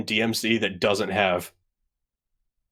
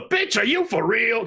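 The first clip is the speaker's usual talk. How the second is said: Rudy Ray Moore voice; 12 semitones higher than usual. Retold or imitated. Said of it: "that was a Morrison accent".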